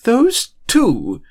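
shrill high burst of exasperation; exasperated outrage. A narrator's reading. McGonagall finding her wand swapped, outraged exclamation. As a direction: explode in fed-up outrage. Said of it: "she exclaimed in exasperated outrage".

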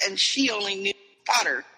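usual speaking voice

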